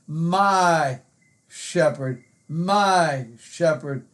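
"my" drawn out slowly, set apart before "shepherd"; stress on my. Shown as a demonstration. Preacher showing that the Psalm is personal, stress on "my". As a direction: dwell on "my" as the personal word. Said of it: "I like that pronoun".

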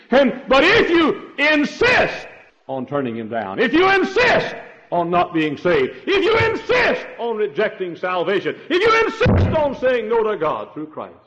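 high, loud preacher's build; preaching at full force. Acted. Preaching climax.

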